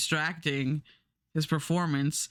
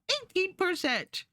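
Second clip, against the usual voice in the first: eighteen squeaked much higher; dismayed high repeat of eighteen; mock dismay. Real, not acted. Puzzle progress surprise.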